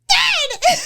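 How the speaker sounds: shrieked way up high, loud; mock high-pitched scream. Wife imitates her furious husband, screeching high. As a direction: imitate him shooting up the octaves. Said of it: "that's the voice that she always uses".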